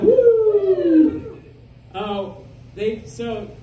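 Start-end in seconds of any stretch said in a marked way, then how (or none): 0.0-1.4 woo. Real, not acted.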